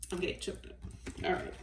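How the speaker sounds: very soft, fighting back tears; trying to suck the emotion back; quietly overcome, voice nearly gone; choked up. Real, not acted.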